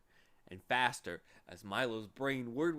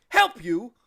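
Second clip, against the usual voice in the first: high, loud incredulous bark; incredulous watchdog; 12 semitones higher, 17 dB louder than usual. Acted. Watchdog scoffing at Milo's plea, loud. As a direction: throw the question back, amazed.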